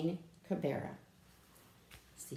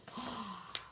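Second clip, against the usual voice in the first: gasp; 3 semitones higher, 9 dB quieter than usual. Pretend. Surprise at crocodile.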